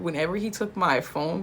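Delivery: ordinary delivery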